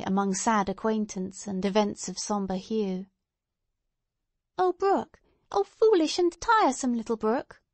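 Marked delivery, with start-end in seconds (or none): 4.6-7.6 put-on high, lilting child's exclamation; high-pitched little girl scolding playfully; crying out as child Pearl. A narrator's reading. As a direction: call out in a small girl's high voice. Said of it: "cried Pearl".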